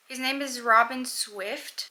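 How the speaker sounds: ordinary talk, nothing marked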